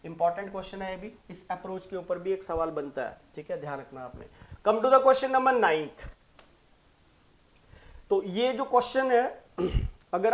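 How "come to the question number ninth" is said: question announcement. Acted.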